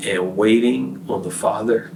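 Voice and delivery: ordinary talk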